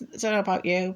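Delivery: ordinary talk, nothing marked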